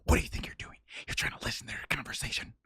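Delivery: harsh angry whisper; whisper yelling. Acted. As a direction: hiss it like a scolding whisper. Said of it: "whisper yelling at him".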